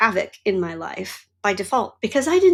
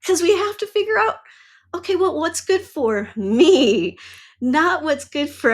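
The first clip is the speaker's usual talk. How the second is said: laughing, higher and lively; 8 semitones higher than usual. Real, not acted. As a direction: push through the laughter with energy. Laughs while urging rebellion.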